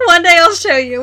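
high voice still full of laughter; laughing. Real, not acted. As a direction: talk while getting over a laugh. Still laughing after accent joke.

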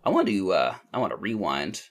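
usual speaking voice

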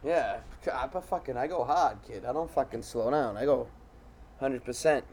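a hint of a grumpy old ballplayer, soft; gruff old man. Comic playing an achy old softball player, in a low grumbling voice. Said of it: "I'm a fucking old man".

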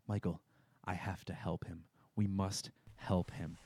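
low, soft, slow swooning voice; Fiona impression. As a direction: drag it out, lovestruck and hushed. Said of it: "inexplicably is just like".

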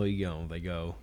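ordinary talk, nothing marked

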